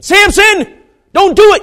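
high, urgent yell; shouted warning. Acted. Preacher warning Samson off, urgent shout. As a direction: cry out to stop him.

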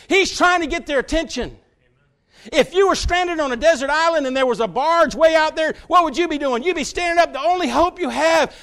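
loud, high preaching build; preaching at full force. Acted. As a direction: drive the point home from the pulpit.

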